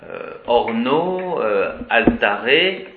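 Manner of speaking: slow, with the last A of "rosa" drawn out long; long final A. Shown as a demonstration. Teacher showing the long ablative A of "rosa". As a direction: stretch the A at the end of "rosa". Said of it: "c'est un A plus long".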